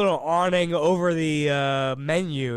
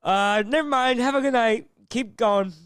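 higher, brushing him off; curt brush-off, pitched up; mock dismissive clerk; 6 semitones higher, 3 dB louder than usual. Drive-thru lady dismissing the rude customer, higher and curt. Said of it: "and they go".

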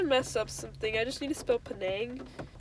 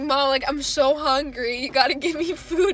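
whiny hungry plea; 2 semitones higher, 8 dB louder than usual. Retold or imitated. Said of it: "And I was like".

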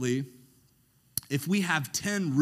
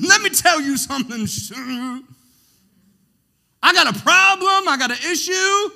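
loud, high, charging in; exaggerated confrontation; 14 semitones higher, 13 dB louder than usual. Pastor hamming up charging into a tough talk, loud. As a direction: barge in shouting your complaint. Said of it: "I'm exaggerating".

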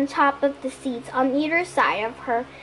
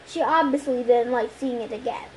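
plain reading voice

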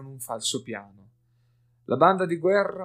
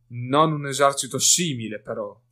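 growled; 8 dB louder than usual. A narrator's reading. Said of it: "ringhiò Hazer".

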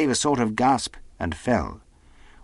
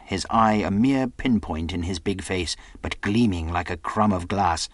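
ordinary delivery

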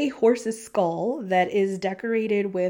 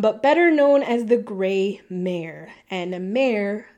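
ordinary talk, nothing marked

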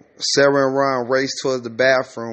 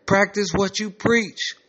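higher, slower and insistent; raised voice; 7 semitones higher than usual. Acted. Emmanuel ranting to his roommate about the facility, voice raised. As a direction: raise the pitch and stretch it out. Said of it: "Emmanuel's voice is raised".

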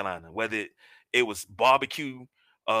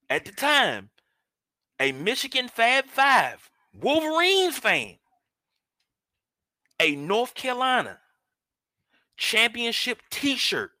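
high, halting, drawn-out disbelief; incredulous outrage; 10 semitones higher, 4 dB louder, slower than usual. Real, not acted. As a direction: spell out the outrage phrase by phrase. Mock outrage at mother's wrong gift.

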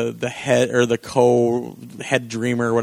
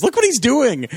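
high-pitched indignant complaint, retold; mock pissed off; 10 semitones higher, 3 dB louder than usual. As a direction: imitate a fed-up guy's outburst, pitched way up. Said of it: "like, all pissed off".

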